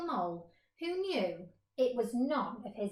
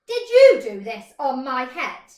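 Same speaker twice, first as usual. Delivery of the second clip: much louder accusing question; indignant shout; 15 dB louder than usual. Acted. Mole shouting up at the grazing horse.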